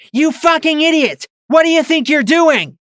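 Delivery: high, loud furious yell; angry yell. A narrator's reading. Mare turning on Twist, yelling furiously. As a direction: scream at her in rage.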